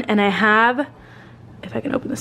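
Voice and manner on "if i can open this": whisper. Real, not acted. Struggling with a lid.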